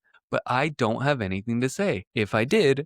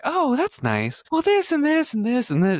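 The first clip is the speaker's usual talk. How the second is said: very high imitation of chatty mom; mom chatting; 5 dB louder than usual. Imitating mom rambling on the phone, very high. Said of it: "And she was like".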